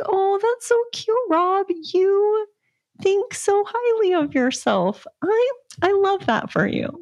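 sugary high mock praise; sarcastic sweet. Mocking Rob's ego, high sugary sarcastic praise. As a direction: coo it sweetly but mean it sarcastically. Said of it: "I was like".

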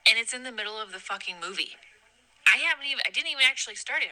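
normal talking voice

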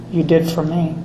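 ordinary talk, nothing marked

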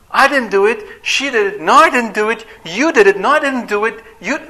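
put-on high, loud, squabbling kids' voices; childish blame game. Squabbling children shift blame, high and loud. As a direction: bicker like guilty children pointing fingers.

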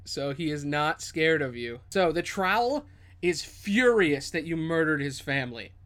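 ordinary talk

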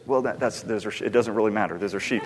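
put-on embarrassed low mutter to a child; flustered mumble. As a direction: brush the question off under your breath. Flustered reply to child's question.

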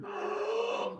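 a sudden high gasp of delight; quick high surprised intake of breath; sharp gasp. Real, not acted. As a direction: gasp as if amazed by the taste.

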